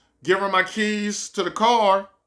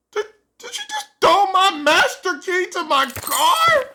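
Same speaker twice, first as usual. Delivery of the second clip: disbelieving shout; 11 semitones higher than usual. Retold or imitated. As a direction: yell it at her in disbelief. She threw his master key.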